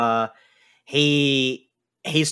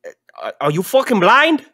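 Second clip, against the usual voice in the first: high, loud, furious little dwarf; squeaky enraged dwarf yelling from below; angry dwarf; 7 semitones higher, 5 dB louder, faster than usual. Acted. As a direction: bark it up at him like a pint-sized brawler. Dwarf insults the player.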